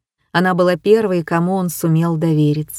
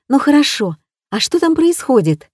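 put-on excited young girl; 7 semitones higher than usual. A narrator's reading. Fawn curious.

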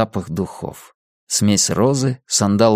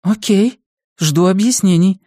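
expectant mother; 10 semitones higher, 3 dB louder than usual. Acted.

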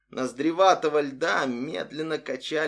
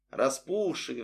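usual speaking voice

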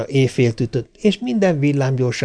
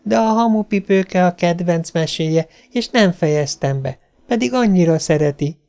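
lightly voiced little girl, higher pitch; protesting child; 6 semitones higher than usual. A narrator's reading. Girl protests to mother for Robbi's story, pleading. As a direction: make one last small plea.

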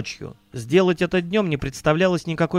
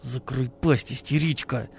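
hateful hiss; 4 dB quieter, slower than usual. A narrator's reading. Man who slapped the businessman hisses at him with hatred. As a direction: hiss it with loathing, held down. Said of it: "с ненавистью прошипел он".

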